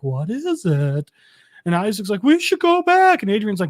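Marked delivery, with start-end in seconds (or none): 0.0-1.1 slow, low, spooked murmur; whisper-like wonder at the unknown; hushed unease. Retold or imitated. Isaac facing the glowing thing, slow and low. 2.2-3.2 much higher, louder, anxious; mock scared plea. Isaac begging Adrian to turn back, high and nervous.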